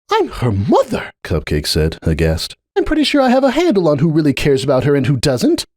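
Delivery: an offended mother bristling; Cupcake. A narrator's reading. Cupcake aghast at Starlight, defending her place.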